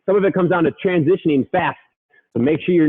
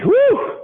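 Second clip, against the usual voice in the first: excited whoop. Real, not acted. Coach finishing the dips lets out an excited whoop. Cheer after finishing the round.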